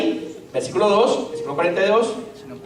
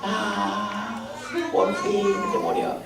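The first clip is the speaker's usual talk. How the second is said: mock relief; 5 dB quieter, much slower than usual. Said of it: "le decían".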